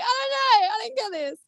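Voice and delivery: high, flustered helpless whine; mock helpless. Mum admitting she can't play Minecraft, high mock-helpless whine. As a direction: play the clueless gamer mum.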